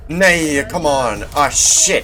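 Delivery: high, loud angry yell; frustrated shout. Real, not acted. Gamer hit by the enemy, frustrated yell. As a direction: bellow at the bad luck.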